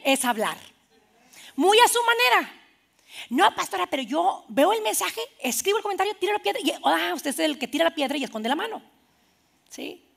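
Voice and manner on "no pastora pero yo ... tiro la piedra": higher-pitched innocent protest; self-excusing church member. Acted. Church member excusing online comments to pastor, high protest. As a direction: plead innocence in a lifted, put-on voice.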